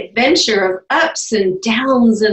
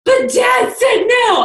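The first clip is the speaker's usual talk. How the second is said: whiny daughter; 13 semitones higher, slower than usual. Retold or imitated. Daughter's voice in retold argument.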